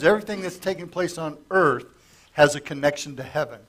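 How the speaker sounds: usual speaking voice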